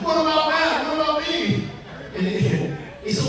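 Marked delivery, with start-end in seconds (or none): 0.0-1.7 high, whining what about me; whiny mock self-pity. Matt mocking his own worry to the booker, whiny and high.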